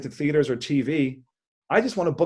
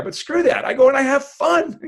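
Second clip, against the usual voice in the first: gleeful defiance; 11 semitones higher, 5 dB louder than usual. Real, not acted. Dismissing magic-guy magic.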